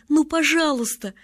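put-on high, insistent child's plea; pleading. A narrator's reading. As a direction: beg with the pitch pushed up.

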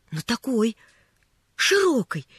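ordinary delivery

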